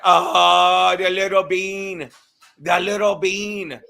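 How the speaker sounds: slow, stretched-out fond exclamation; drawn-out cooing. Real, not acted. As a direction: coo over it, stretching each word. Fondly exclaiming over little bean.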